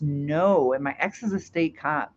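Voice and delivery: ordinary talk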